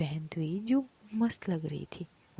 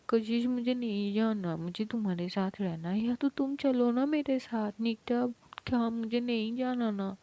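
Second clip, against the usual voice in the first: tearful pleading; 5 semitones higher than usual. Acted. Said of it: "वो बहुत रो रहा था।".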